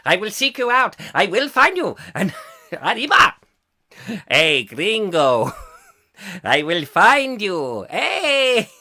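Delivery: hammed-up Mexican accent, drawn out; jokey Mexican accent with swooping pitch. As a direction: stretch it like a cartoon Mexican bandit. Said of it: "better with a Mexican accent".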